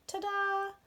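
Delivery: high, bright reveal. A narrator's reading. Rabbit proudly reveals the carrot to Bear, delighted. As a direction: show off the surprise.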